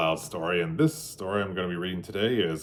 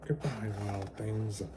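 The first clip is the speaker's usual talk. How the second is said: much softer farewell; soft goodbye; 11 dB quieter than usual. Acted. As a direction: wave them off gently. Max waves goodbye.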